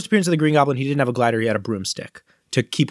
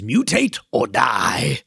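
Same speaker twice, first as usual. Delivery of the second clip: put-on Southern accent; much slower than usual. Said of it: "Again, Southern accent".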